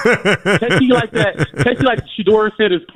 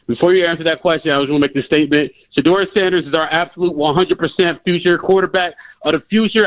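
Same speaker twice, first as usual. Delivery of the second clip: ordinary delivery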